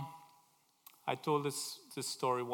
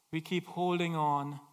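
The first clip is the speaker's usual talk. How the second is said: ordinary talk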